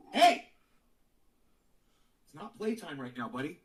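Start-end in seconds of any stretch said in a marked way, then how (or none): 0.1-0.5 louder, higher, a sudden Hey; sharp yell. Real, not acted. Owner yells at the rowdy dog, loud and sharp. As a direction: snap the dog out of play.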